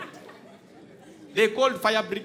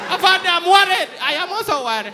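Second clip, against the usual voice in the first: worried shout; 9 semitones higher, 7 dB louder than usual. Retold or imitated. Trapped man and officer shouting.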